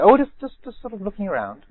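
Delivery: high, flustered lady's voice, the last words fading; flustered woman. Retold or imitated. Trespassing woman brushing off the monk, high and evasive. Woman dodging the monk's question.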